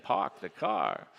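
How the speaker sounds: lightly imitated Boston accent; a quick mock Boston accent. Shown as a demonstration. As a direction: put on a bit of thick Boston. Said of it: "You ever been to Boston?".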